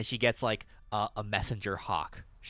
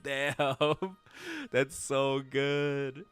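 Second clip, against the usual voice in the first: laughing amazement; 5 semitones higher, much slower than usual. Retold or imitated. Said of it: "I was like".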